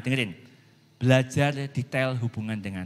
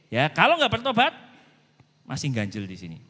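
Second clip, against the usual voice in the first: usual speaking voice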